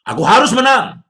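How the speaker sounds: loud, high proclamation; retold triumphant cry; declaration. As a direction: shout the faith out. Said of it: "Tuhanku hidup".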